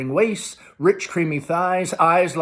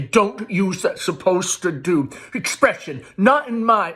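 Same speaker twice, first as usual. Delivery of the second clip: higher, louder scolding mother; shrill anger; 6 semitones higher, 4 dB louder than usual. A narrator's reading. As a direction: scold the son in outrage. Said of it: "Amanda shrilly".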